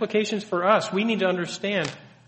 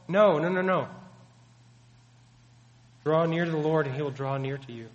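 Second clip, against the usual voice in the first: ordinary talk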